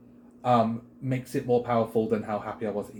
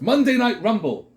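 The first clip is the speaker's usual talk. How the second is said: loud, higher-pitched hype call; mock announcer shout; 11 semitones higher, 9 dB louder than usual. Host mocking a weekly Rumble, loud announcer shout. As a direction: belt it out like a show title. Hypes an imagined show name.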